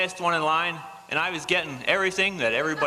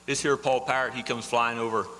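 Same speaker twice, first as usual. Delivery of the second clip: usual speaking voice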